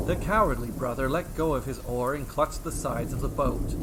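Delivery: ordinary delivery, nothing marked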